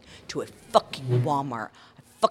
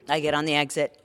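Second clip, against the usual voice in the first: ordinary talk, nothing marked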